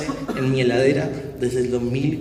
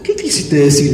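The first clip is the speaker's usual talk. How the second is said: laughing; 8 dB louder than usual. Retold or imitated. Wife's question, laughing, louder.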